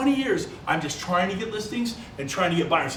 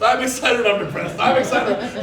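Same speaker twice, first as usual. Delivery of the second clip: louder, higher swings between moods; swinging excited and depressed; 4 semitones higher, 6 dB louder than usual. Acted. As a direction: bounce up and down like a roller coaster. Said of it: "This is the life of every real estate broker".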